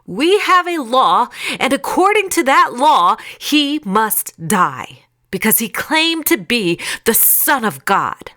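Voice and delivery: high, loud accusation; insisting. A narrator's reading. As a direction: insist on it forcefully, slowing for the verdict.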